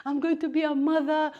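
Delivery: imagining the thrill of a first pregnancy; excited expectant mother. Retold or imitated. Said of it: "you're thinking".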